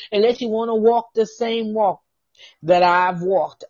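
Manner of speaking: loud, insistent preacher's challenge; emphatic preaching. Real, not acted. Preacher challenging listeners about the walk, forcefully.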